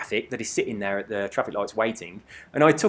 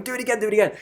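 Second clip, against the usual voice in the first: lightly mimicked excited lads, pitched up; high mock-eager pleading at normal volume; stupidly excited; 8 semitones higher, much faster than usual. Lads begging the rider for another wheelie, mock excited. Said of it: "they were stupidly excited, going".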